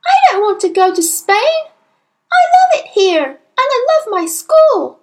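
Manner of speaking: put-on very high, loud child's plea; upset child pleading loudly; protesting cry. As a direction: wail in protest like a little girl. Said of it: "Matilda cried".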